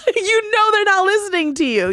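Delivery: talking through a real laugh; giggling through the words. Real, not acted. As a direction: laugh while you say it, high. Laughing at distracted husband.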